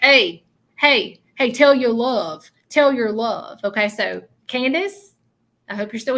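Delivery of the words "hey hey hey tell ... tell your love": lightly voiced young man calling, louder and higher; spirit's message. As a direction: call out eagerly like a young guy from the other side. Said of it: "the way that it's coming through is like".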